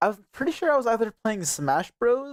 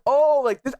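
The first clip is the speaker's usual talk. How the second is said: mock-innocent "oh", pitched up; mocking imitation; 6 semitones higher than usual. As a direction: play dumb while mimicking an accent. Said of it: "I just wanted to keep mocking him".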